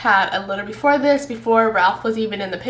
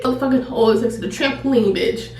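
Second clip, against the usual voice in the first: ordinary delivery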